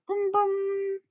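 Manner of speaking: put-on high, childlike brum brum; toddler car noise. A narrator's reading. George pretending to drive, childish engine noise. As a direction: rev it like a toy car.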